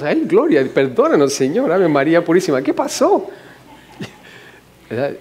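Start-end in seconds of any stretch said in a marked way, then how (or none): 0.0-3.3 scandalized saints. Retold or imitated. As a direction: play the shocked church folk, pitch climbing. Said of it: "Y los santos que estábamos". (santos escandalizados, voz aguda y exagerada)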